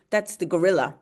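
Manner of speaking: ordinary talk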